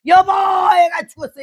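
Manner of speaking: high, loud, slow frantic cry; panicked shout. Shown as a demonstration. Wife screams to husband, loud and high. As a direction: scream that the child died. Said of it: "이러지 않고".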